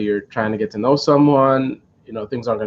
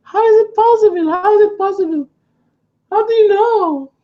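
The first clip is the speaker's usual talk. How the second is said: much higher, louder, astonished mock voice; imitating her shock; 4 dB louder than usual. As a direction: squeal her disbelief, over and over. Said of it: "And she was shocked".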